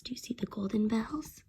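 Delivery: hushed storytime aside; whispered aside. Real, not acted. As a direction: lean in and whisper.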